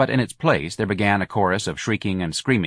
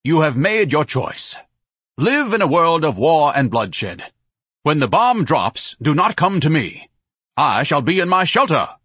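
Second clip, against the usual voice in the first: solemn pompous warning; 7 semitones higher, 5 dB louder than usual. A narrator's reading. Ignatius grand warning.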